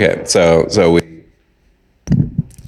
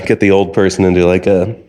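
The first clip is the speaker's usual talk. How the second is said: ordinary delivery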